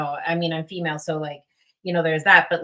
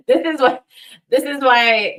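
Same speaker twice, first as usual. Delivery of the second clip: laughing; 7 semitones higher, 9 dB louder than usual. Real, not acted. Laughing, embarrassed at herself.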